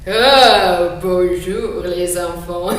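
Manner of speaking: loud, slow booming wolf voice; big bad wolf. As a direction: boom it out like a puppet wolf. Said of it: "c'est le loup". (voix de grand méchant loup tonitruante)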